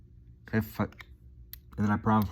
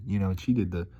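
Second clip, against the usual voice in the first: ordinary delivery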